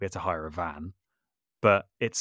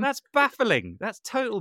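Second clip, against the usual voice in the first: high, loud incredulous cry; a playful high yelp of disbelief; baffled squeal; 14 semitones higher, 3 dB louder than usual. Real, not acted. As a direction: sound thoroughly baffled by the idea. Exaggerated disbelief at organ pedals.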